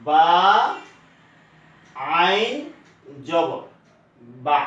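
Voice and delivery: বা আইন জবর বা spelled out piece by piece; teacher sounding out বা আইন জবর বা syllable by syllable; slow reading demo. Shown as a demonstration. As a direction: read বা আইন জবর বা slowly, one part at a time. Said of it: "পড়তে হবে এইভাবে".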